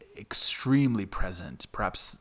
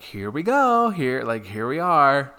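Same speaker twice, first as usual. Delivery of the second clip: nervous excitement; 6 semitones higher, 10 dB louder than usual. Retold or imitated. Nervous quote heading up stairs.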